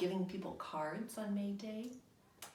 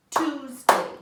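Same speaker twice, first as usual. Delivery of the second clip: loud, split as Tues-day; Tuesday split into two loud clapped beats; clapping syllables; 5 semitones higher, 14 dB louder than usual. Shown as a demonstration. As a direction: clap out each beat of Tuesday loudly. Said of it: "let's clap out the syllables in Tuesday".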